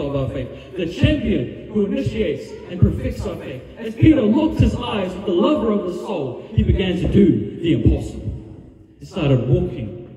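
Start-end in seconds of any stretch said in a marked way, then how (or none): 0.7-8.2 higher and louder, building to a shout; full-force preacher's crescendo; preaching climax. Acted. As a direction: lift the congregation with rising fervour.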